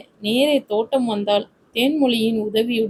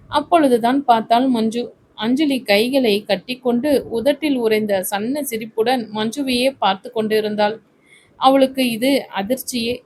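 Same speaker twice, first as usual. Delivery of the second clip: ordinary delivery, nothing marked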